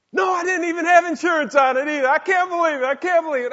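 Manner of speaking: very high, loud squeal of disbelief; brother's mock disbelief. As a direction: play the brother shocked. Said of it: "He said".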